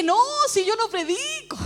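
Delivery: high-pitched disbelieving protest; incredulous laughing denial. Retold or imitated. Preacher answering God in disbelief, incredulous denial. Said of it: "me largué a reír".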